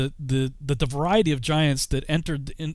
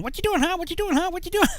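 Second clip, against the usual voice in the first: much higher squawky parrot imitation; faster than usual. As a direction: mimic the talking parrot, high and nagging. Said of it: "he's going".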